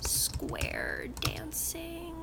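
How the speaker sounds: breathy whispered aside, slowed. Acted. Reading out a skill list, she whispers this one. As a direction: slip the odd skill in under your breath.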